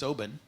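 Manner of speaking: Oban as "OH-bun", stress up front; first syllable of Oban stressed, ending reduced to "bun"; local pronunciation. Shown as a demonstration. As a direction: say Oban with a swallowed second syllable, "OH-b'n". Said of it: "how the people who live there say it".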